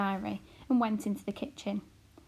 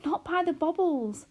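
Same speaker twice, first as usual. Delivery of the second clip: anxious mother; 6 semitones higher, 6 dB louder than usual. A narrator's reading. Homily protests.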